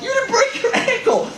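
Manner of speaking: high, laughing retort; mock disbelief, laughing. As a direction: scoff through a laugh.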